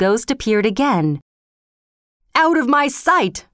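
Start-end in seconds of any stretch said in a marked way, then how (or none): 2.3-3.5 high-pitched, controlled roar. A narrator's reading. King banishes the returning ghost, roaring. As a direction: order it away with a raised, forceful cry. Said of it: "roared the king".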